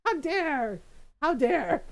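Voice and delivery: high, loud outraged yelps; hammed-up offended cries; mock indignation. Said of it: "He's like".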